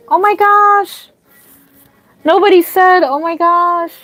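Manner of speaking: overjoyed at the donation; excited squeal. Real, not acted. Another donation arrives.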